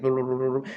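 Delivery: rolling run of imitated foreign chatter; mock mumbled babble. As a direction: babble gibberish as if imitating tongues. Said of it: "they were mumbling before".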